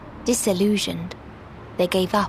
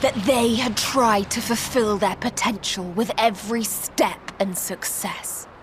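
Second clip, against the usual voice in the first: rising anguish; 4 semitones higher than usual. Acted. Meteion reporting the despair of dead races, rising anguish. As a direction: build from calm report to anguished height.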